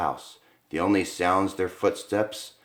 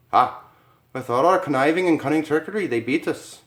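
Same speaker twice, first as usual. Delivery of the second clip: higher, fast rueful villain; rueful schemer; 7 semitones higher than usual. Acted. Schemer conceding to the couple, higher fast rueful voice. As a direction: grumble at being beaten. Con man admitting defeat.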